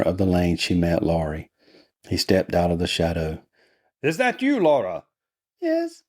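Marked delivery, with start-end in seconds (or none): 4.0-5.0 high put-on voice, falling on the name; concerned brother. A narrator's reading. Laurie finding his sister in the lane, high questioning voice. 5.6-6.0 put-on very high falsetto girl; young girl's reply. A narrator's reading. Laura answering her brother in the lane, girlish falsetto.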